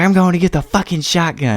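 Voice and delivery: high, shrill mock threat; angry threat. Mother threatening the boys with a shotgun, high angry mock yell. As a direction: mimic her threatening to fetch the gun. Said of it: "And she's like".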